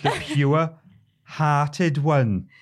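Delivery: loud, high, very slow Welsh lilt; attempted Welsh accent. Co-host retrying the Drippy impression, exaggerated Welsh accent. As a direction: stretch it out in a big Welsh character voice. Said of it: "do an impression of Mister Drippy".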